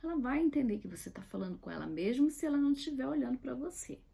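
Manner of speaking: ordinary delivery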